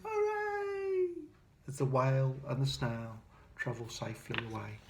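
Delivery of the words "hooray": very high joyful cheer; villagers' happy hooray. Acted. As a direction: celebrate the whale's rescue. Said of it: "villagers shouting".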